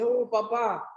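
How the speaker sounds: lightly acted wide-eyed wonder; surprised child. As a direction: marvel like an awed little boy. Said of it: "el niño sorprendido le dice".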